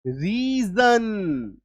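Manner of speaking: रीजन drawn out slowly, with a clear z sound; रीजन spoken slowly and carefully as a model word; slow pronunciation demo. Shown as a demonstration. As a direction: stretch out रीजन so every sound is heard, ending on z. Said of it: "इसका उच्चारण क्या होता है?".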